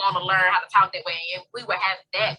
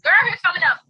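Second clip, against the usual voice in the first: excited; 11 semitones higher, 6 dB louder than usual. Real, not acted. Excited about card appearing.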